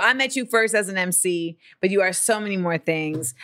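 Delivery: ordinary talk, nothing marked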